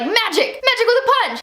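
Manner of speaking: high, gleeful exclamations; excited hero imitation. Spider-Man leaping through magic portals, imitated high excitement. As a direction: play an eager kid hero showing off. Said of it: "and is like".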